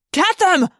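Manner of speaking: high, loud shout; furious alarmed command; yelled order. A narrator's reading. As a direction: sic your men on the fugitives. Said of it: "a human voice yelled".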